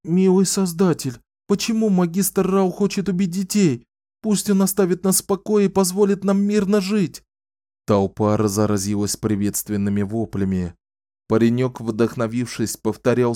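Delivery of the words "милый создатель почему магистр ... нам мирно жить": put-on high, earnest boy's voice; a boy calling out to the crowd; young boy's plea. A narrator's reading. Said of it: "Один мальчик спросил".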